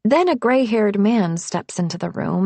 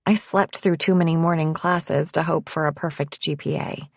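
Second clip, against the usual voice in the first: ordinary delivery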